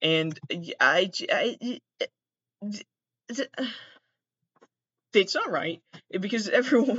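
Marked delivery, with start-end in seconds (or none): none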